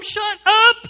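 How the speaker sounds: high, loud shut-down; exasperated shout. Acted. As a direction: burst out to silence a gossip. Cuts off the mimicked excuse.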